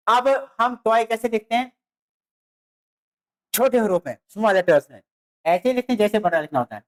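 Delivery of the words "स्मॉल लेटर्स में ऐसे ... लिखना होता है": ordinary talk, nothing marked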